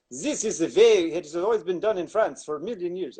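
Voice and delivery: badly faked French accent, drifting German; terrible French accent. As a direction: put on a hammy, muddled French accent. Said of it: "I don't know what accent that was".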